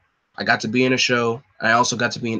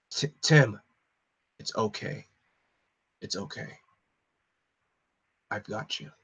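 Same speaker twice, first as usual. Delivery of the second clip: much softer, gentle reassurance; soft comforting; 7 dB quieter than usual. Acted. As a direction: hold him and calm him quietly. Bruce embraces grieving Tim.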